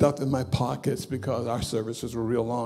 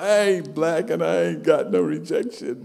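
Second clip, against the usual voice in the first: loud, higher, tearful shout of denial; crying protest; 4 semitones higher, 6 dB louder than usual. Retold or imitated. Bishop on the floor protesting to onlookers, loud tearful shout. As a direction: blurt it out through tears, louder and higher.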